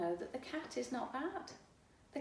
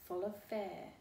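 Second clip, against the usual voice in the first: ordinary delivery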